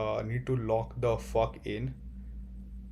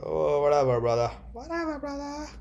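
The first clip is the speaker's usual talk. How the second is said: mock whatever; 5 semitones higher than usual.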